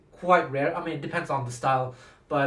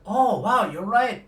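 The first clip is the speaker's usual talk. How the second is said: raised pitch, a sudden dawning realization; surprised realization; 5 semitones higher, 3 dB louder, slower than usual. Retold or imitated. Teacher admitting the student is right, surprised. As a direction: act out being struck by a discovery.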